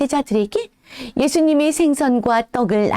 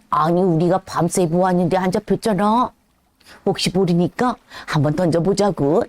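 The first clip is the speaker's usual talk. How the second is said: lower, tired fisherman grumble; weary disciples; 6 semitones lower than usual. Acted. Disciples answering the stranger on the shore, low and tired. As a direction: sound worn out from fishing all night.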